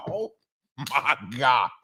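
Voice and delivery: usual speaking voice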